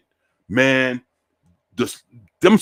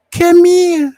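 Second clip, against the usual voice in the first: much higher, louder call to come over; high beckoning voice; 6 dB louder than usual. Retold or imitated. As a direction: coax him over in a squeaky voice. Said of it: "And she was like".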